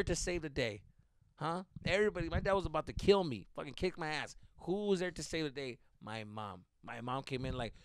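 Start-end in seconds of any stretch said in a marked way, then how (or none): none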